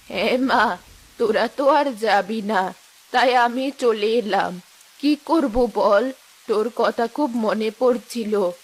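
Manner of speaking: put-on low, slow old woman calling; old mother. Old mother calls her daughter Rehana from the yard, low and slow. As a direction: call out like an elderly mother.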